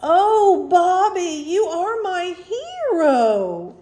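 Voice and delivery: high, loud gushing praise, slowing at the end; overjoyed gratitude. A narrator's reading. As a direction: gush loudly to the rescuer. Grateful teacher hails boy, very happy.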